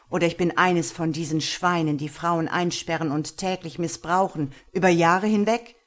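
loud, hard accusing voice; stern interrogating master. Acted. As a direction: confront her with the lie. Master threatens Lena. (Herr verhört Lena hart und laut)

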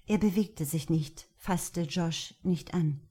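usual speaking voice

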